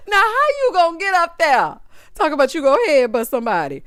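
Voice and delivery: words breaking into laughter; laughing. Real, not acted. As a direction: ask it while cracking up.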